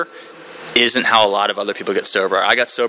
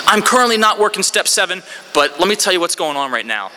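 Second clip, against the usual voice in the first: mock eager oversharer; 9 semitones higher than usual. Newcomer sharing at every single meeting, high eager self-mockery. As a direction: pipe up high like a meeting know-it-all.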